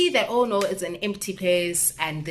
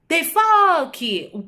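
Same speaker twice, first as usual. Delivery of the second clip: high, loud, slow mock outburst; outraged retort; 10 semitones higher, 7 dB louder, much slower than usual. Telling viewers her retort to a stranger, loud and slow. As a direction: fire it back at the catcaller, drawn out. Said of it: "I'm like".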